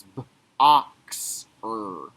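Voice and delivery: "B, ox, er" split into separate sounds; sounding out. Shown as a demonstration. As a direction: stretch each sound of "boxer" apart. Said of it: "hear the sounds and write".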